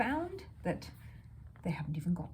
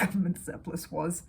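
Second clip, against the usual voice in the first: usual speaking voice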